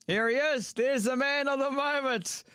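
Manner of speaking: big slow announcer build-up, pitched high. Acted. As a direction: roll out the guest like a ring announcer. Introducing the guest with fanfare.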